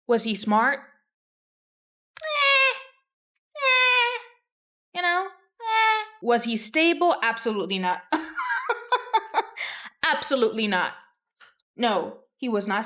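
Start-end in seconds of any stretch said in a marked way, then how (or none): 2.2-6.0 high, drawn-out doubtful "eh"; hedging noise. Real, not acted. Host dodging whether the king was smart, high hedging squeak. 8.1-10.1 high rattling laugh. Real, not acted. Host laughing over the king being stable, high laugh.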